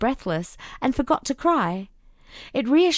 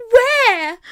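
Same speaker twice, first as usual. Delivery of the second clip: high, loud desperate call; panicked child calling out; frightened cry; 11 semitones higher, 7 dB louder than usual. A narrator's reading. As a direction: cry out lost in the dark. Lost child calling brother.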